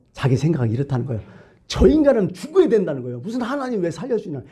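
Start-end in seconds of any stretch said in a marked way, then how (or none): 1.7-4.4 high-pitched indignant grumbling; stubborn protest. Acted. Preacher voicing Jonah objecting to God's mercy, stubborn protest. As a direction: argue back in a raised pitch. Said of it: "자기 고집".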